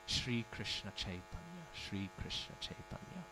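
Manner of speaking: slow, soft, fading into breath; faint dying words. Retold or imitated. Dying saint softly calling the name in final moments, fading whisper. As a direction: let the name slip out slowly as life ends.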